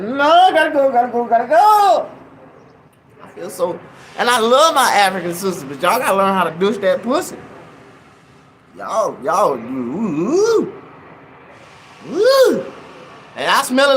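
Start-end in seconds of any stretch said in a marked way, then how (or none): 0.0-2.1 loud, high, rushed; panicked exit. Retold or imitated. Fleeing the smelly church, loud and panicked. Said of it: "I'm like". 9.5-12.7 ooh climbing to a high crying wail; mock gagging wail. Recalling the church smell to the audience, high mock crying wail.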